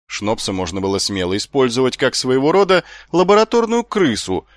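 ordinary delivery, nothing marked

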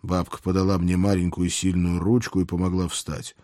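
ordinary talk

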